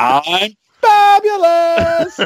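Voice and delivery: high, drawn-out, sing-song fabulous; flamboyant "fabulous". Retold or imitated. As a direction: gush it out slowly, way up high. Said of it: "You're like".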